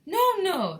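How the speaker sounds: high, loud hasty plea; urgent protest. A narrator's reading. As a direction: plead loudly to hold off. Wonka protests against being sick.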